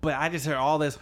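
normal talking voice

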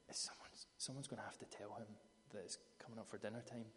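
hushed, unvoiced whisper so Jesus won't hear; whispered aside. Disciples whispering among themselves about dinner, hushed whisper. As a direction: whisper it behind the teacher's back.

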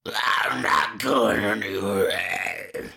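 Nick Nolte impression. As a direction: drag it low with a sob. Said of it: "the dad being like".

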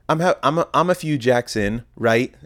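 ordinary delivery

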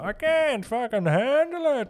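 high, loud put-on Yukon complaint; hammed-up cry of someone at the end of their rope; exasperated Yukon resident. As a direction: screech it in mock despair. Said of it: "they're all from the Yukon".

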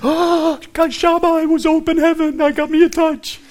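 gasping, very high ecstatic cry; ecstatic mock rapture. Mock visitation experience.

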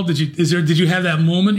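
ordinary talk

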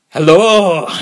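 loud, high-pitched Scottish hello; Billy Connolly. Acted. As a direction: belt out a mock Scottish greeting. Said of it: "What about Billy Connolly?".

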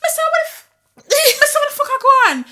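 high, loud squeal of disbelief; mock outraged disbelief. Woman recalling shock at coworker's antics, squealed disbelief. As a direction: squawk it out, aghast at the spectacle. Reacting to colleague's sudden antics.

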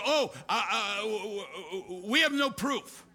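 hammed-up stuttering old man with a laugh; mock stammering Biden. Preacher mocks Biden stammering a denial, half laughing. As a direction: sputter like a confused old president. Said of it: "and Biden says".

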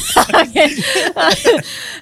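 loud laugh up high; real burst of laughter. Real, not acted. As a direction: let out a big real laugh. Laughing at the dealer joke.

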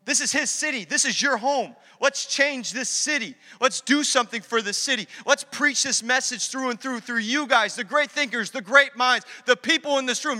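high, urgent appeal to the crowd; rally them, building to a big finish; preacher voicing a rousing Paul; impassioned rallying cry. Acting out Paul rallying the Athenians.

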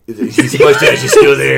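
loud words through laughter; laughing complaint. Real, not acted. Critic mocking the villain's leftover mustache, laughing loudly. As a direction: crack up at the absurdity.

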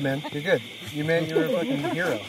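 normal talking voice

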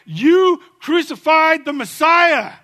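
slow, loud, high accusation; accusing shout. Retold or imitated. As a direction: hurl the charge at them slowly. Apostles confront leaders at Pentecost.

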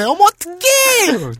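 long drawn-out high wail; loud, slow, hammed-up "what do I do"; gossipy ajumma. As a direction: stretch the fussing interjection out shrilly. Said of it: "취임새가 길어요".

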